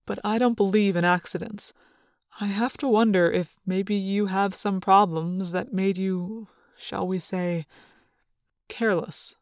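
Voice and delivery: low, soft, measured adult voice; Chapman, careful suspicion. A narrator's reading. Chapman probing Rachel's accident, quietly careful. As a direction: tread carefully, slowing toward the delicate last word.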